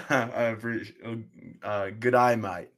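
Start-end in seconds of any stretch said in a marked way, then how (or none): 1.9-2.7 put-on overdone Australian accent, louder; exaggerated learner's Australian accent; attempted Aussie accent. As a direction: clumsily copy an Aussie with wide "eye" vowels. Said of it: "you've gotta copy that accent".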